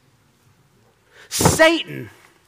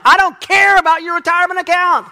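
much higher, louder exasperated outburst; mock exasperated retort; 11 semitones higher, 6 dB louder than usual. Preacher snapping at a man bragging about his retirement account, in a loud mock outburst. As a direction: blow up at the bragging investor.

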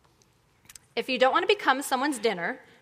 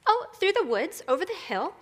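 put-on high, bouncy little girl voice; sing-song cheerful storybook child; 7 semitones higher than usual. A narrator's reading. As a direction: answer brightly and innocently, lilting each phrase. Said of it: "answered Little Red cheerfully".

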